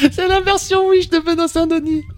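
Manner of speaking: laughing. Real, not acted. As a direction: squeeze the line out mid-laugh. Laughing at the mangled name.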